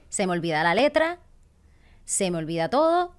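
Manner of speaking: usual speaking voice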